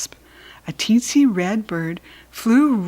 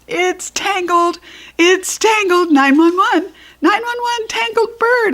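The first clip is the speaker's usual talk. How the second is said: frantic holler, much higher and louder; 12 semitones higher, 5 dB louder than usual. Acted. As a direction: holler for help, screeching even louder. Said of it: "I hollered".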